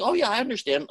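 high, airy put-on agreement; mock Frost reply. Frost answering the puzzled audience member, mock innocent. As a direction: play the poet cheerfully pretending to understand.

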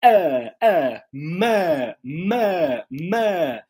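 "ma" drawn out with a strong open vowel; carrot vowel in mother. Shown as a demonstration. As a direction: stretch "ah" and "ma", rising then falling. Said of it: "O começo é o carrot".

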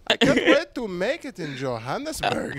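high mock Swedish accent; hopeful student in a hammed-up Swedish accent; Swedish kid accent. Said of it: "blue-eyed Swedish".

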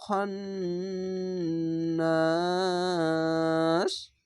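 slow chanted recitation, last word held long; teacher demonstrating tajweed; Quran recitation. Shown as a demonstration. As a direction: chant it and stretch the final নাস. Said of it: "এখানে ইকফা গুন্না".

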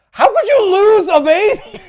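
shrill, loud, high-pitched squeal of disbelief; hammed-up shocked family yelling; mock outrage. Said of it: "are all like".

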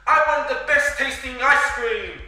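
high, loud excited cry; shouted wish. Acted. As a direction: call out the wish with full gusto. Mai shouts out her wish.

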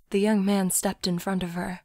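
ordinary delivery

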